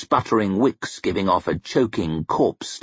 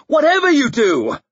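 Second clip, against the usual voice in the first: screeched; 5 dB louder than usual. A narrator's reading. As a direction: warn him off in terror, much higher and louder. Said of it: "screeched Denker".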